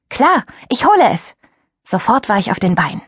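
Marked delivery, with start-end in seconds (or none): 0.1-1.3 much higher, keen reply; quick bright eager answer from a teenage girl. Acted. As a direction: jump at the chance. Narrator eager to fetch game.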